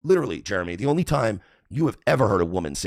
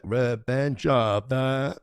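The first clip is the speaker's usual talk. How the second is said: very slow, drawn out and pitched higher; imitated sounding-out; 4 semitones higher, much slower than usual. Streamer replaying how Jeremy said the show's name, slow mocking imitation. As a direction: drag each word out as if reading it for the first time. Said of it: "how he said".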